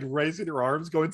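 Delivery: normal talking voice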